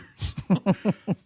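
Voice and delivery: loud, high burst of laughter. Real, not acted. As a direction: laugh out loud at your own story. Laughs after retelling his retort.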